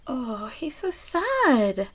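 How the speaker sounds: soft, slow whispered sympathy; gentle storytime whisper. Real, not acted. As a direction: hush it like sharing a secret. Whispered sympathy while reading.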